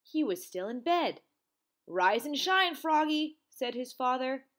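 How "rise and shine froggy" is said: high, loud morning call; wake-up call. A narrator's reading. Father waking Froggy for school, wake-up call. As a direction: rouse a sleeper, high and loud.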